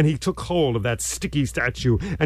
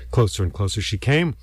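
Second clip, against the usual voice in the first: ordinary delivery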